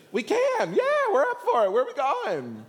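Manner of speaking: very high, loud, hammed-up excitement; clueless eagerness. Brothers answering Jesus, not knowing he means murdered, eager. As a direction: pipe up like an over-keen kid, totally oblivious.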